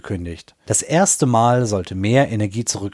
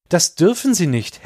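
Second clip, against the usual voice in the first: urgent; 8 semitones higher than usual. Acted. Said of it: "Seine Stimme klang drängend".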